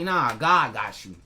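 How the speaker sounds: slow, low and steady, like a calm promise; calm reassurance. Retold or imitated. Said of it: "you're like".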